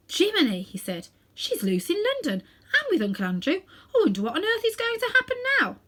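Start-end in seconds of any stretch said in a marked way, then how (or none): 0.1-0.6 high startled cry; startled exclamation. A narrator's reading. Diggory startled as the door closes. 1.3-5.8 put-on high, fast anxious boy; boy's voice. A narrator's reading. Diggory fretting about the witch in London.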